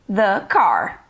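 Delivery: "the car" with "the" as short "thuh"; THE before a consonant. Shown as a demonstration. English teacher modelling "the car" for learners, "the" said "thuh". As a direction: model "the car" clearly, "the" rhyming with "duh". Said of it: "o som de consoante, nós vamos pronunciar".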